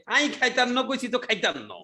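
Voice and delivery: a quick taste of Noakhali speech; Noakhali dialect. Retold or imitated. Teacher imitating a Noakhali speaker, in Noakhali dialect. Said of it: "কেউ নোয়াখালীর মানুষ তো সে স্টাইলে বাংলা বলছে".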